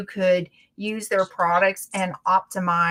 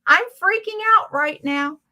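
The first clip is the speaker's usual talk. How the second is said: high, acted freak-out; high-pitched mock panic; retold panic; 10 semitones higher, 4 dB louder than usual. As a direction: act out panicking on the table. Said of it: "And I went".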